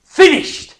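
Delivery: "Finished!" shouted high and loud in triumph; cried out. Acted. Jesus on the cross crying out, triumphant shout. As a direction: ring out the word like a victory cry. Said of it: "cried out".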